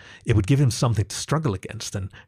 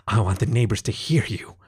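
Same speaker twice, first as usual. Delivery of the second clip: ordinary delivery, nothing marked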